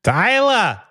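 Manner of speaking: mock holler for Tyler, high and drawn out; imitated dad's yell. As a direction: yell the name like a dad across the house. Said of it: "said the word Tyler like that".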